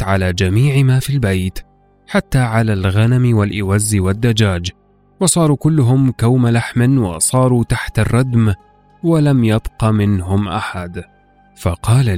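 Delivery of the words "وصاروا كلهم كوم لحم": ordinary delivery, nothing marked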